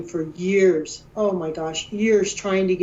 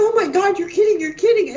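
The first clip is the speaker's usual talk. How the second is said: much higher, louder retold squeal of disbelief; freaking out; 12 semitones higher, 3 dB louder, faster than usual. Wife hears husband found the crash scar, freaking out. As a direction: relive the moment you lost it with joy. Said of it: "I'm just freaking out".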